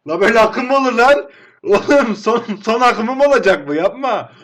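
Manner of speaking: furious gamer yell at the screen; outraged shout. Real, not acted. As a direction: shout at the game in disbelief. Outraged at the game.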